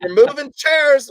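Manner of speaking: high-pitched mock summons; calling out. Comic hunting for Dennis in the audience, calling out. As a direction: call out across a room for someone.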